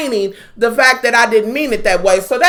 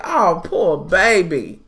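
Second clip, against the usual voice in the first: mock pity; 6 semitones lower, slower than usual. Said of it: "I'm like".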